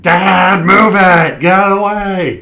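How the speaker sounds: high, loud cartoon villain yell; Jim Carrey Grinch. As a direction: shriek it like a panicked cartoon villain. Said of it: "it's like the Jim Carrey Grinch movie".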